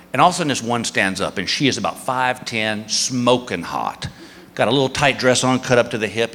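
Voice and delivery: ordinary talk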